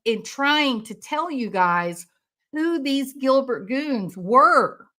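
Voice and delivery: ordinary talk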